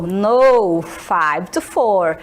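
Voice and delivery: slow, low teaching read; slow clear answer. Shown as a demonstration. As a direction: say it slowly so students catch every word. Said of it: "a segunda garota falou". (resposta lenta e grave)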